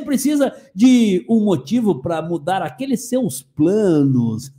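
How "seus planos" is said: slow, low villain's purr; mustache twirling villain. Acted. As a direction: savor the words like a villain. Evil ad pitch before laugh. (vilão maquiavélico, lento e grave)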